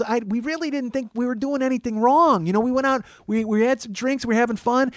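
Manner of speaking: high-pitched Wisconsin woman explaining herself; Wisconsin accent. Retold or imitated. Old schoolmate excusing her group's noise, Wisconsin accent. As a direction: protest innocence in a high Wisconsin voice. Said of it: "she's like, very Wisconsin".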